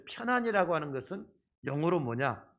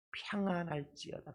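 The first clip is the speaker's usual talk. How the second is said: soft; 8 dB quieter than usual. Shown as a demonstration. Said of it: "그렇게 말씀하십니다".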